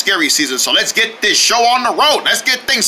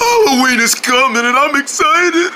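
hammed-up gushing, higher; overdramatic excitement; 5 semitones higher than usual. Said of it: "not that emotional".

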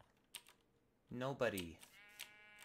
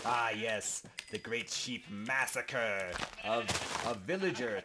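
grand title; 5 dB louder, slower than usual. Acted.

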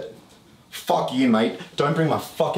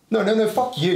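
heated, higher-pitched refusal; real yelling; 6 semitones higher than usual. Real, not acted. Mid-quarrel with a mate, angry yelling. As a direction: yell it back at your mate. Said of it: "Don't yell".

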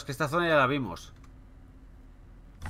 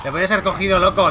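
shouting; 5 semitones higher, 10 dB louder than usual. Real, not acted. Near miss with enemy.

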